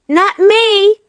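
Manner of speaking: high, loud frightened cry; panicked scream. A narrator's reading. Bunce refusing to go down the hole, panicked scream. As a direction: shriek and run away. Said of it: "screamed Bunce".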